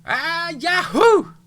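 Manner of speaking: long joyful whoop; screaming. Acted. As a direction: whoop it out high and loud. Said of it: "A chorus of voices screamed".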